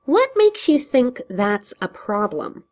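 high, slow, menacing question; Joker voice. Acted. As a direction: lean in and needle him. Joker menaces too close.

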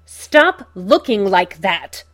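loud, slow, furious bark. A narrator's reading. Joker to Bruce who wilts, furious bark. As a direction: snap at him in sudden fury. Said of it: "Joker barks, suddenly furious".

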